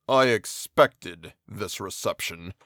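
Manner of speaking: slow, low, sorrowful monster voice; a wretched giant speaking heavily; brooding creature. Acted. The creature answers his hostile maker, slow and low.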